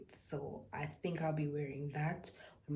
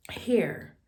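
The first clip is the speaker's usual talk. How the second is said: whispered word; 5 semitones higher, 8 dB louder than usual. Real, not acted. Hiding hair at a Pentecostal church.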